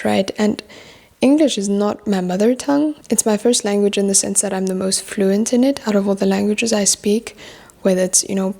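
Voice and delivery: ordinary talk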